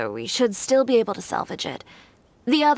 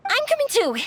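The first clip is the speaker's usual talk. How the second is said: eager insist. Acted. Eager to join the search.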